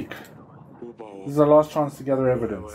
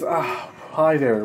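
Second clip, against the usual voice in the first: normal talking voice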